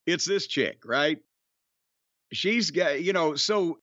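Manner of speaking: ordinary talk, nothing marked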